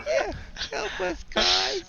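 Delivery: very high, slow puppet squeak; squeaky puppet voice, drawn out; puppet kobold voice. Acted. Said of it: "change my voice for each of the puppet".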